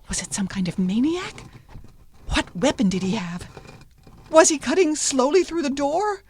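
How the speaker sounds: questions climbing to a high, loud finish; mounting alarm. A narrator's reading. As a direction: narrate her panic as it builds. Girl's terrified guesses about the attacker.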